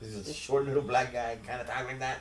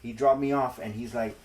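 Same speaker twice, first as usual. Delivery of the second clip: ordinary delivery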